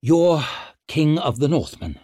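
forced out through clenched teeth; restrained anger. A narrator's reading. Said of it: "breathed in through manfully clenched teeth".

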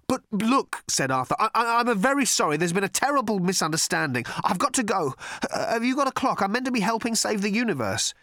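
high, flustered apology; nervous Arthur voice. Acted. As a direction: babble it nervously, backing away. Arthur backing away, apologising.

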